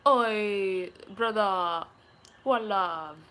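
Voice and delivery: drawn-out mock Jamaican accent. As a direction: imitate a TV Jamaican, each word held. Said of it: "episode with a bunch of Jamaicans".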